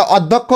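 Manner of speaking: অধ্যক্ষ starting on open "aw", "awd-dok-kho"; mistaken open-o pronunciation. Shown as a demonstration. Teacher shows the common mistake, অধ্যক্ষ as "awd-dok-kho". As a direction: open the first vowel of অধ্যক্ষ to "aw". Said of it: "কিন্তু এটা ভুল উচ্চারণ".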